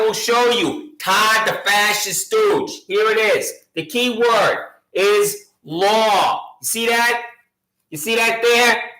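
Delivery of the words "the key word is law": "The key word is law", said slowly, with weight on "word"; stressing the key word. Shown as a demonstration. Pointing out the key word to the sheriff, slow and deliberate. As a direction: lean on "word" and slow it right down. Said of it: "The key word in a foil".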